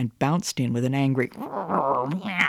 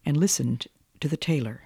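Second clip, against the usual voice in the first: plain reading voice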